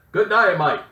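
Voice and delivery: a bold Aussie greeting in a put-on Australian accent; a loud mock Australian hello. Shown as a demonstration. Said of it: "I do a spot-on Australian accent".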